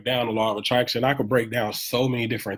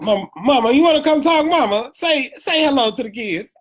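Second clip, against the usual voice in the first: calling out; 12 semitones higher, 5 dB louder than usual. Real, not acted. Calling mother from live stream.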